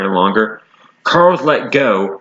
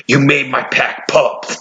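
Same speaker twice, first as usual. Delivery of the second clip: roaring wolf; 2 semitones higher, slower than usual. A narrator's reading. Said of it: "exclaimed Spirit, roaring".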